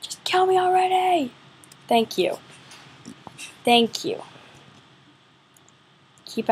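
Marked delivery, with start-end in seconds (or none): 0.0-1.3 exasperated cry. Real, not acted. Despair at the game.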